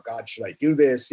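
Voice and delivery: usual speaking voice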